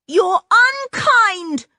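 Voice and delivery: high, loud and slow accusation; girl shouting after her father; angry shout. Acted. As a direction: hurl it at the slammed door. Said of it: "she shouted".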